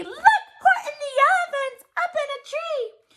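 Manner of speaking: high, mocking jeer with a laugh; gleeful taunting shout. A narrator's reading. Friends jeer at Horton sitting in the tree, laughing. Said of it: "they shouted with glee".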